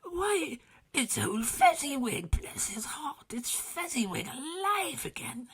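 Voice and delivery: put-on overjoyed at seeing his old master; excited high cry; great excitement. A narrator's reading. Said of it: "Scrooge cried in great excitement".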